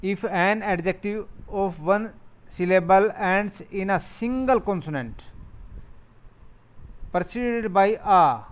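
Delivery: slow, deliberate dictation of the rule; reading a rule aloud. Shown as a demonstration. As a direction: read it out word by word for the class. Teacher reads grammar rule slowly. (नियम धीरे-धीरे पढ़कर सुनाना)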